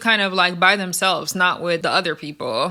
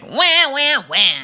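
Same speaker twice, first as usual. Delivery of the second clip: mock crying; 5 semitones higher, 5 dB louder, slower than usual. [name] mocking Lex for crying, mock wailing. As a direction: fake wailing wah sounds, pitched up and louder. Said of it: "making fun of Scotty crying".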